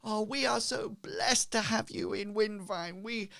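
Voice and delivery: high, gushing crowd praise; cheering townsfolk. Acted. Grateful crowd rallying round the heroes, high cheering. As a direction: hail them in a high, grateful voice. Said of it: "they all start cheering again".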